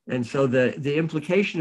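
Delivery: ordinary delivery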